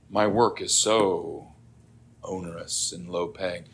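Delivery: low, soft, halting prayer; earnest prayer. Acted. Worried believer asking the Lord for help, earnest quiet prayer. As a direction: pray humbly, pausing between phrases.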